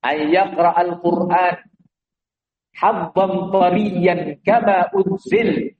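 slow, measured Arabic quotation of the Prophet; preacher quotes the Prophet's saying, slow and measured; quoted hadith. Retold or imitated. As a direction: recite the hadith deliberately, word by word. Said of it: "Nabi mengatakan".

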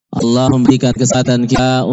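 ordinary talk, nothing marked

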